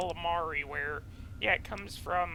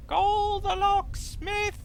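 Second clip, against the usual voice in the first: very high, slow, louder comic cry; squealing high comic shout; Mel Brooks quote; 14 semitones higher, 6 dB louder, slower than usual. Retold or imitated. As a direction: howl it like a Mel Brooks gag. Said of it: "that's Mel Brooks".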